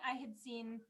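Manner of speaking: usual speaking voice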